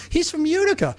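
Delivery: imitating a thrilled restaurant owner; excited owner. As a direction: play the owner lighting up.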